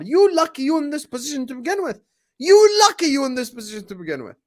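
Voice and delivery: very high, loud, strained yell; shouting at Pep, furious. Real, not acted. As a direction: scream it at him. Ranting at Pep.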